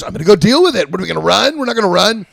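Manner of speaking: loud determined manager telling the band off; firm retort. Retold or imitated. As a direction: plant your feet and refuse to run. Manager retorts, refusing to flee.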